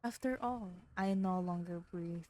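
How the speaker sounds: usual speaking voice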